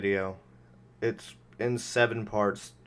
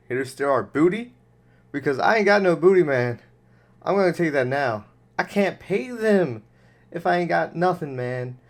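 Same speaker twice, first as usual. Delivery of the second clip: raised, high-pitched comic outburst; mock indignant protest; 6 semitones higher, 6 dB louder than usual. Host scoffing at being robbed of booty, mock indignant.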